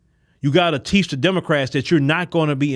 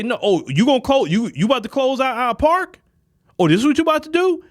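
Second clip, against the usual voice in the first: high, rising indignant taunt, quick at the end; mock indignant challenge; 8 semitones higher than usual. Voters confronting an official over a park closing, high indignant taunt. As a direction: call the official out in disbelief.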